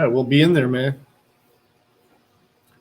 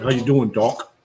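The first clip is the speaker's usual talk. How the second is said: put-on light New York accent greeting the doc; a hint of a New York accent, casual. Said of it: "Best New York accent".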